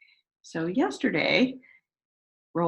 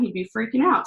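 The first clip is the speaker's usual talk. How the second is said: ordinary delivery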